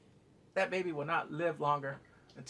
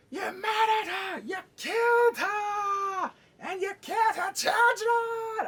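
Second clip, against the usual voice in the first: high, drawn-out shouted accusation; Oberyn; 7 dB louder, slower than usual. Acted. Fan mimicking a vengeful accuser, hammed-up shouting. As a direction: stretch each phrase into an over-the-top yell. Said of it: "I always think of Oberyn".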